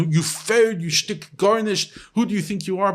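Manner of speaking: hammed-up scornful reprimand; harsh mock scolding. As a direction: act out an unloving mentor berating someone. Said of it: "in a loving way, not like, you know".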